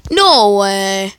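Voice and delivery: can't believe what just happened; loud disbelief. Real, not acted. Gamer yelling at the screen, loud disbelief.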